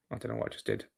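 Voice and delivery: very low, soft, voiceless mumble; muttered aside. Real, not acted. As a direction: mumble it to yourself, barely voiced. Puzzled aside to himself mid-stream.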